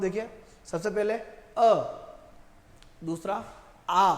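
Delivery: अ short, आ held with the mouth fully open; demonstrated vowels. Shown as a demonstration. Teacher demonstrating how to say each vowel. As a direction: show the difference: short अ, then open long आ. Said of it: "एक बार मैं बोलकर बता रहा हूँ".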